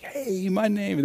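high, drawn-out little cheer, kept soft; softly gushing, stretched and lifted; mock delight. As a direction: imitate a thrilled kid seeing his name. Kid spots his name in paper.

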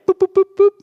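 high, loud playful boop sounds. Acted. Preacher making silly boop noises to the crowd. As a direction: toss out silly boops to lighten the point.

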